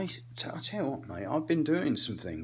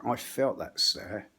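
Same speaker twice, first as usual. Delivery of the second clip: ordinary talk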